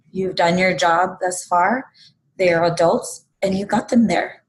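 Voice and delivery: ordinary talk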